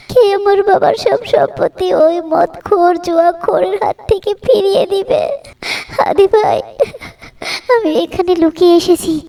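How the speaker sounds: high-pitched weeping plea, broken by sobs; tearful pleading. Acted. Abused wife begs Hadi to save her father, sobbing. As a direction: beg for rescue in a high, crying voice.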